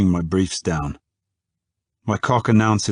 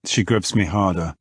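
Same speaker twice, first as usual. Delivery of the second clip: ordinary delivery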